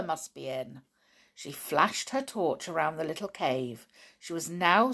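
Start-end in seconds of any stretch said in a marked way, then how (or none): none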